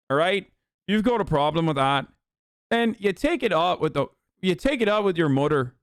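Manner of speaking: combative put-on Irish accent. Streamer challenging a chatter in mock Irish accent. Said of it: "do an Irish accent".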